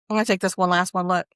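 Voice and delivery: ordinary talk, nothing marked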